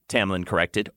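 plain reading voice

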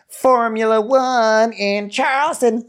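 high, slow, drawn-out mock proclamation; mock race announcer voice; hammed-up announcer. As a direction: announce it grandly. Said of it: "Grace is like".

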